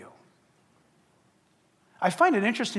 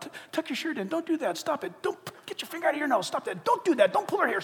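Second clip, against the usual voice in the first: higher, scolding; nagging parent; 7 semitones higher than usual. Retold or imitated. A parent scolding the kids over and over, higher and scolding. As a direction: rattle off rules like a strict parent. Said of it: "parents are all about".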